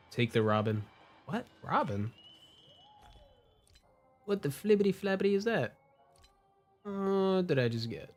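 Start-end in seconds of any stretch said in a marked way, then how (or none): none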